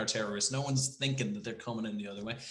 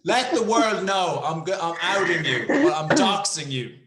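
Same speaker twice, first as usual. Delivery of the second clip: louder, higher, laughing gloat; playful laughing announcement; laughing tease; 4 semitones higher, 7 dB louder than usual. Real, not acted. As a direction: gleefully threaten to expose them. Teasing laughter, threatening to expose.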